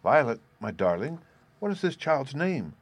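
ordinary delivery, nothing marked

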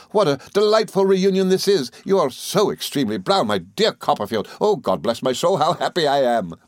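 high, effusive gentleman, overjoyed; excitable friend. A narrator's reading. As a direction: gush with happiness at a reunion.

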